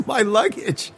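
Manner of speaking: high-pitched mock fretting; Ben Carson. Quoting the interviewee who runs away, high mock alarm. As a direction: pitch it up in comic imitation. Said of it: "He's just like".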